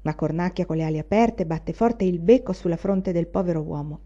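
usual speaking voice

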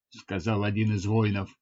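plain reading voice

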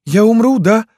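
very high, loud wail; frightened girl. A narrator's reading. Wounded Lyuska fears dying and asks Yegor, frightened. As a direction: ask it in a high, desperate cry.

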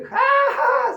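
very high, loud, slow belly laugh; guru's infectious laugh, slow and loud; big laugh. Retold or imitated. Guru laughing.

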